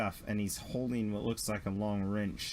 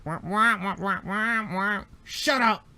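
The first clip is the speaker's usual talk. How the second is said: mock wailing; 9 semitones higher, 6 dB louder than usual. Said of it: "you just hear".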